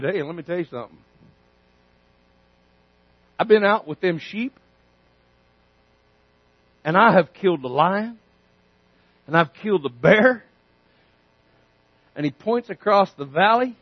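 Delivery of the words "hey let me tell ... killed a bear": calm David. Acted. David calmly boasts.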